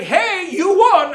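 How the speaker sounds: high, slow cheerful call; cheery announcement. Retold or imitated. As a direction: announce it like a prize. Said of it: "he's like".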